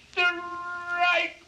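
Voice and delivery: high, drawn-out umpire cry; umpire's call. A narrator's reading. Umpire calling the pitch on Casey, high ringing cry. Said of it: "the umpire said".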